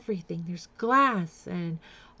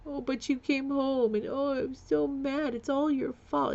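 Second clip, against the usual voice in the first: higher, whiny blaming mom; mock mother blame; 6 semitones higher, 3 dB quieter than usual. Mimicking her mother blaming her, higher and whiny. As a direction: play the self-pitying narcissist mother. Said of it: "She's playing that".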